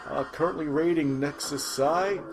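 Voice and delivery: ordinary talk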